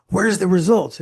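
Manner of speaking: mock angry demand for results; demanding boss. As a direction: bark it like an impatient boss who wants numbers. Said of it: "not like".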